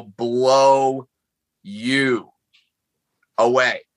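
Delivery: each word stretched, long pauses between; very slow. Pretend. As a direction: say it word by word, drawn right out. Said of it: "say very slowly".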